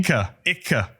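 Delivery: Icke, Icke, each ick-eh with a hard k; Berlin Icke. Shown as a demonstration. Host repeating the Berlin word for listeners, hard k. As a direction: repeat Icke, two syllables, pointy k. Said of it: "two syllables out of ich".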